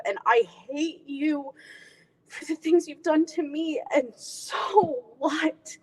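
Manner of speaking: intimate, heartfelt audition monologue with long pauses; a daughter confronting her mom, measured and pained; dramatic monologue. Acted. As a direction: play it as a wounded daughter.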